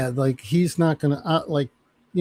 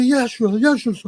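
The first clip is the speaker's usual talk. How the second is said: eager recruit; 9 semitones higher than usual. Retold or imitated. Recruit eagerly answering sergeant.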